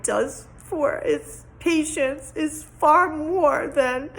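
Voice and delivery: tearful, laughing through tears; crying. Real, not acted. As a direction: voice cracking, emotional thanks. Tearful thanks for the program.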